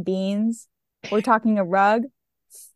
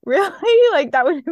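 excited; 7 semitones higher, 6 dB louder than usual. Retold or imitated. Excited reaction to gift.

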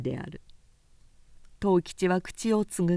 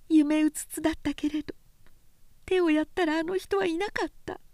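high, inwardly anguished woman's voice; bitter, hurt thoughts voiced high; inner cry of a young woman; 10 semitones higher than usual. A narrator's reading. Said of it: "心の中で叫んだ".

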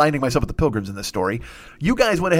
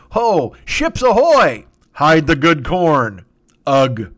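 slow, louder hammed-up lookout call; mock lookout cry; 5 dB louder, much slower than usual. Native lookout warning his tribe of ships, slow loud mock cry. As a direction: bellow it like a panicked lookout.

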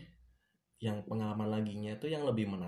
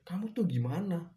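higher and a touch louder, an imitated scold; scolding boss; 8 semitones higher, 4 dB louder than usual. Boss scolding the new waiter, imitated scold. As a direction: tell off the clumsy new waiter. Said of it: "Awal aku kerja langsung dimarahin".

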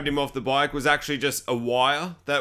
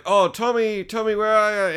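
raised singsong call; mock calling out; 6 semitones higher than usual. Cops looking for victim in shed, mock calling out. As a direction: lightly call for someone out of sight.

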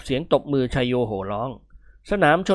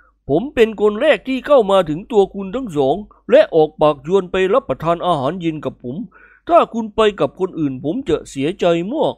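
put-on voice, slightly higher, persuasive; smiling nobleman; 4 semitones higher than usual. Nobleman patting the hero's shoulder, smiling and persuasive. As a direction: press the invitation with a smile. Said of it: "ยกมือตบบ่านิกรแล้วพูดยิ้มๆ".

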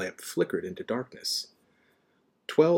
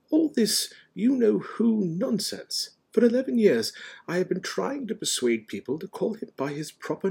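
reasoning wizard, pitched up; Dumbledore; 10 semitones higher than usual. A narrator's reading. Dumbledore urging McGonagall to say the name, patient. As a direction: persuade patiently, landing firmly on the name.

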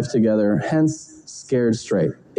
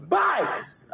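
yelled tic; 6 dB quieter than usual. Shown as a demonstration. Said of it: "I get, like, verbal tics".

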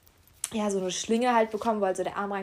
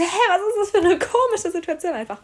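high, loud incredulous outburst; hammed-up confusion; bewildered; 10 semitones higher, 8 dB louder than usual. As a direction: exclaim in baffled disbelief. Baffled thought as doctor walks off.

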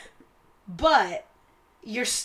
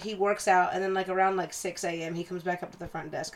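ordinary talk, nothing marked

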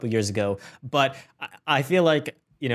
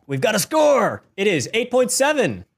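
high, louder reveal; excited announcement; 7 semitones higher, 4 dB louder than usual. Real, not acted. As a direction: announce it like a game show host. Announcing the score.

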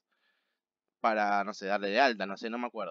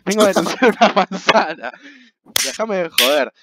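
exasperated; 7 semitones higher, 7 dB louder than usual. Retold or imitated. Mocking absurd police complaint.

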